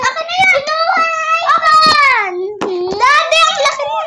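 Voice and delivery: high, whiny plea to Daddy; tearful protest. Real, not acted. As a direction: cry out in complaint to Daddy. Child protests to Daddy over iPad. (paiyak na reklamo)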